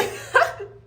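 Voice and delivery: high breathy laugh; burst of laughter. Real, not acted. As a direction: laugh at yourself. Laughing after own rant.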